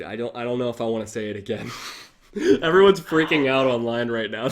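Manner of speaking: ordinary talk, nothing marked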